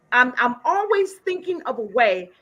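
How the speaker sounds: ordinary talk, nothing marked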